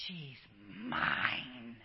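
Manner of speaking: low, slow and tender; God's tender claim. Acted. God looking at his child, low and tender. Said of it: "He looks at you and goes".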